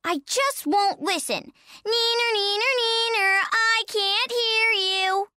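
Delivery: put-on bratty high child taunt; singsong little kid refusing to listen; Baby Pine. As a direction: chant it high, drawing out the vowels. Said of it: "Baby Pine".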